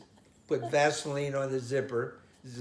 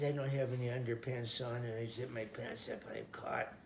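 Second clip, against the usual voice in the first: mild crying; 8 dB quieter than usual. Retold or imitated. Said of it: "And he's crying".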